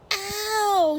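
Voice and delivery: high, loud yelp of pain; sudden loud cry of hurt; pained howl. Acted. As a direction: howl like you just grabbed a hedgehog. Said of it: "he howled".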